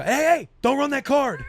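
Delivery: high, drawn-out mock-alarmed call; panicked stop. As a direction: call out to halt the payment, half laughing. Reenacts stopping the card payment.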